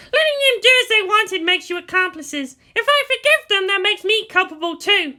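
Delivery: put-on high, loud lecturing girl; haughty Asuka. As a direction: lecture them from above.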